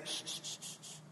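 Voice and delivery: whispered shh, very soft; gentle whispered shushing; hushing. Acted. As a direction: hush a worried child in a whisper. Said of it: "y Dios te diría".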